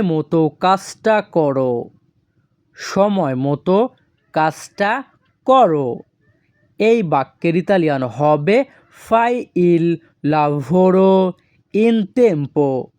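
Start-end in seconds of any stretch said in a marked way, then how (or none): 2.7-6.0 "সময় মতো", "কাজটা", "করো" with pauses between; word by word. Shown as a demonstration. Teacher repeats it in separate pieces. 9.1-12.9 FAI IL LAVORO, IN TEMPO drawn out slowly; slow, careful "fai il lavoro", pause, "in tempo"; slow model phrase. Shown as a demonstration. Teacher models the Italian phrase slowly.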